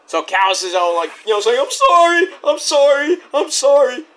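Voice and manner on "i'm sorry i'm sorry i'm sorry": high, loud mock whimpering; hammed-up panicked pleading; pleading apology. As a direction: beg in a squeaky put-on voice. Callis pleading apology, acted.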